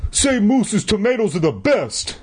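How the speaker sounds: high, bossy command; ordering. A narrator's reading. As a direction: order it like a bully on top. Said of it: "he ordered".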